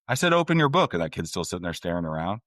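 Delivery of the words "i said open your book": loud, high-pitched exasperated order; mock frustrated teacher. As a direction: imitate a teacher losing patience. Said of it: "you get those frustrating in the classroom".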